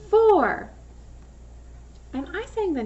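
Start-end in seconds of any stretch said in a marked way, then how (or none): 0.1-0.7 high emphatic model word. Shown as a demonstration. Teacher calling out for for pupils to repeat. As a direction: call out for clearly. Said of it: "I'm gonna say it and I want you to repeat".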